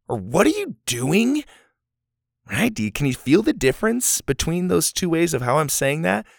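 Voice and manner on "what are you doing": high, accusing demand; angry. Shown as a demonstration. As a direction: demand it crossly, as if to stop them. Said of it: "I'm angry with what you're doing".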